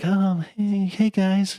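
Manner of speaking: mild, shy turtle voice, pitched high; high, unassertive hello; Donnie. Retold or imitated. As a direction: imitate a reserved guy's timid greeting. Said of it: "it was, like, very quiet".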